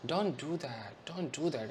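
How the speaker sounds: soft, gentle, slow plea; tenderly. Shown as a demonstration. Teacher shows a tender warning, soft and gentle. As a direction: tell a child softly to stop. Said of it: "said tenderly".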